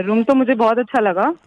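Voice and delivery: usual speaking voice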